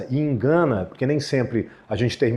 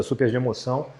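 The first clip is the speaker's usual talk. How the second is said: normal talking voice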